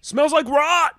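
high, loud hype man shout; energy drink ad. Acted. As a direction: sell it like a pumped-up ad. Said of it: "that's one of his favorite lines".

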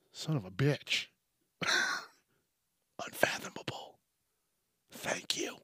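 whispered "Unfathomable" to yourself; soft self-congratulating whisper; triumphant whisper. Real, not acted. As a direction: whisper it, pleased you finally got it. Whispering triumph after getting word.

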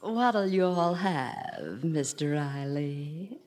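mock sultry collector. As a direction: tease him with a sultry imitation. Wife imitates cute collector.

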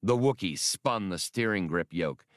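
usual speaking voice

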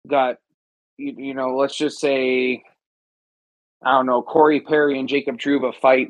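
ordinary talk, nothing marked

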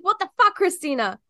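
exasperated at the constant calls; annoyed outburst. Retold or imitated. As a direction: bark it like an interrupted busy person. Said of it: "I was like".

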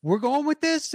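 high, incredulous mock question; baffled onlooker. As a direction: question it as a stunned colleague. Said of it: "Damon's looking around going".

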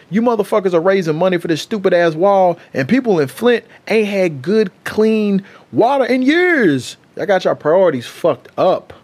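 fed-up outburst, rising in pitch; indignant rant. Real, not acted. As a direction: rant it, stretching out the key words. Indignant rant about Flint water.

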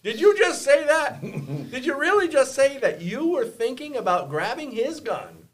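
high, loud, appalled questioning; incredulous challenge. Retold or imitated. Friend confronts woman about grabbing his gun, retold incredulity. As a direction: challenge them in high, emphatic disbelief.

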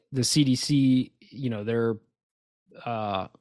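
usual speaking voice

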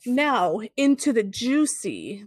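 slow, drawn-out suggestive segue; lewd teasing. Pretend. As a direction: linger on each word suggestively.

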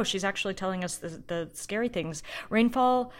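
ordinary talk, nothing marked

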